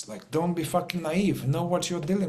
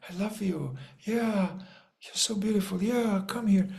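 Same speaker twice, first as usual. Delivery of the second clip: higher, softer, dreamy mock brotherly love; mock blissed-out hippie; 4 semitones higher, 3 dB quieter than usual. Mocking naive people who hug everyone, soft and sugary. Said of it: "people are like".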